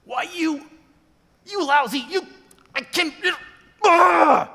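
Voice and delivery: high, sputtering mock rage ending in a yell; too enraged to finish, break into a roar; hammed-up speechless fury; furious. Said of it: "the king is furious".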